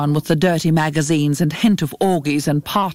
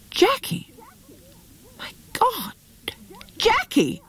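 high screamed call; 4 dB quieter, slower than usual. A narrator's reading. Woman shocked by a death notice calls her friend, high cry. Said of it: "she screamed".

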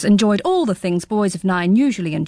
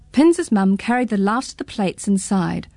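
ordinary delivery, nothing marked

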